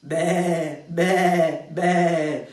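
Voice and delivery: high, loud bleating "Be, be, be" like a lamb; sheep bleat. Acted. Speaker showing the audience their chorus line, bleating. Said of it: "tenéis que decir".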